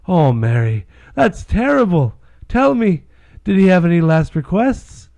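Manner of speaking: loud, swooping priest voice; priest's shocked sympathy. Acted. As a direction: ham up the priest's sympathy, projecting. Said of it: "The priest says".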